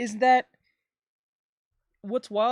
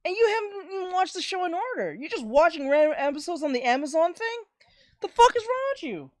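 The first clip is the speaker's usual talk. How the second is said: furious yell at dad; 8 semitones higher than usual. Retold or imitated. Yelling at his dad for watching episodes out of order, mock-furious. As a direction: blow up at him like you can't believe it. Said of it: "I was like".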